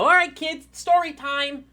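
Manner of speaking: put-on high, loud singsong call to children; daycare lady announcing. Daycare lady gathers kids for stories, high cheery call. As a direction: call the little ones together in a high voice. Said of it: "you hear the lady go".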